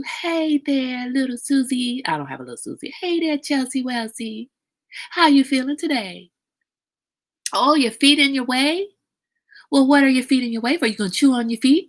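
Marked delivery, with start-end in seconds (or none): 0.0-2.0 put-on higher, gentle baby-talk voice; soft baby talk. Shown as a demonstration. Talking to a baby in a higher cooing voice. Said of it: "you can soften your voice a little bit". 3.0-11.9 put-on higher, faster sing-song baby talk. Shown as a demonstration. Playing with a baby, higher cooing baby talk. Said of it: "You can talk like that to the, to the baby".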